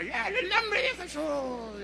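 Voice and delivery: thin, high, stretched-out invocation; imitated evangelist. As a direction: mimic a showy revival evangelist. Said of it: "andaba yo imitando a aquel evangelista".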